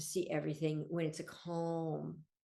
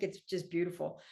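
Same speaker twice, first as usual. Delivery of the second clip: usual speaking voice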